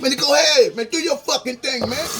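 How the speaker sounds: high, loud, heated telling-off; angry rant. Real, not acted. Vlogger shouting at ungrateful haters, loud and high. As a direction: chew them out at full heat.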